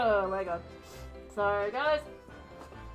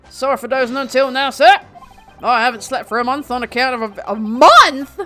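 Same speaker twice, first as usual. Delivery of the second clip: constable voice; 3 semitones higher, 7 dB louder than usual. Acted. Plays sleepy constable.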